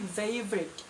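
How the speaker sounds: favorite said the British way, v softened, slow; British pronunciation. Shown as a demonstration. Teacher demos favorite in British English. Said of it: "yang British".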